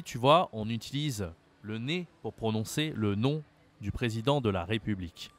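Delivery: normal talking voice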